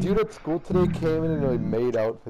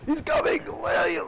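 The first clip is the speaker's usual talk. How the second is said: high frantic warning; panicked shouting; slower than usual. Real, not acted. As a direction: yell in a panic.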